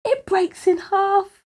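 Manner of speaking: high, slow, aghast; hushed-awe storytelling, pitched high; shocked narration. A narrator's reading. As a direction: draw out the disaster in dismay. Shock as the car breaks.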